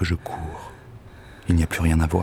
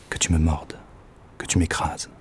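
softer and softer, down to a breath; fading whisper; 4 dB quieter than usual. Acted. Waiting on her steps for his lover, fading whisper. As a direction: let the wish sink into a whisper.

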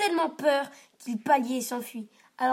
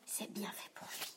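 whispered, smug; gloating whisper; 3 semitones lower, 14 dB quieter than usual. A narrator's reading. Simon watching his brother bullied, smug whisper. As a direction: whisper it with satisfaction.

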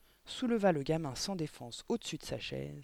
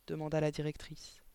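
ordinary delivery

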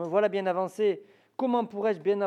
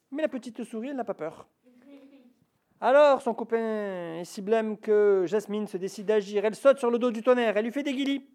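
plain reading voice

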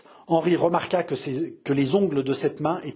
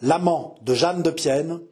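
usual speaking voice

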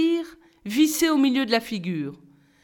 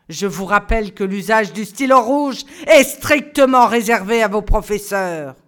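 strict teacher rules; 6 dB louder than usual. A narrator's reading. Stern teacher reading the grading rules to the class, loud and measured. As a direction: lay down the law one point at a time.

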